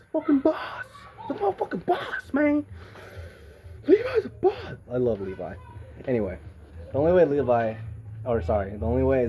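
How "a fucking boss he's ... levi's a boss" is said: very high, laughing gush; squealing fangirl. Real, not acted. Fan raving about Levi, in a very high laughing squeal. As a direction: squeal with delight.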